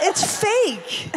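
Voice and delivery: high, laughing punchline; laughing reveal. Real, not acted. Friend laughing while revealing the fake accent, high. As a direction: burst out laughing as you say it.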